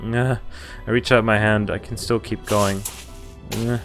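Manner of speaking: ordinary talk, nothing marked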